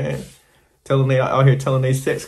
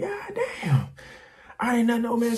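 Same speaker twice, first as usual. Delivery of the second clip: much higher, awed exclamation; amazed; 8 semitones higher, 7 dB quieter than usual. Retold or imitated. Narrator recalling Japanese girl washing him, high amazed voice. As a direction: marvel at it, pitch jumping way up.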